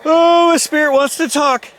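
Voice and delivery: sudden spooked outburst; startled alarm. Real, not acted. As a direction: react as if the room just answered. Spirit box reacts, startled.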